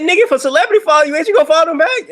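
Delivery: loud, high, rapid-fire challenge; exasperated outburst. Retold or imitated. Arguing with her about following back, exasperated outburst. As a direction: squeal it out in disbelief.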